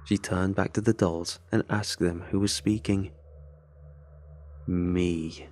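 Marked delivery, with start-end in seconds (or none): 4.7-5.5 low, eerie doll voice; flat low answer. Acted. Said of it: "the low voice responded".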